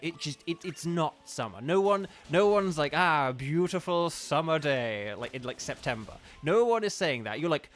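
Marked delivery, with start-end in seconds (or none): 2.9-5.1 slow, savouring mock admiration; hammed-up contented sigh of words; mock blissful. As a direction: draw it out like someone basking, tongue in cheek. Mock quote of someone enjoying summer.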